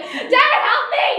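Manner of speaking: high, loud retold cry for help; hammed-up kid yelling for rescue; mock screaming child. Screaming son with a bleeding hand calls his dad, mock scream. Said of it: "he looked over to his screaming son".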